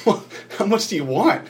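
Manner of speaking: high-pitched imitation, on the edge of a laugh; amused, laughing question. Retelling how the prayer leader laughed and asked, amused question. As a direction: ask it with amusement, voice lifting high. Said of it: "you laughed, and you said".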